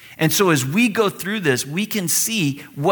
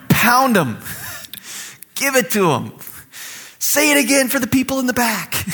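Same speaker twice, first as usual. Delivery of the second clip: raised, strained cheering; mock egging on; 8 semitones higher than usual. Some of us urging Jesus on, mock-eager cheer. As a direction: cheer the fight on from the sidelines.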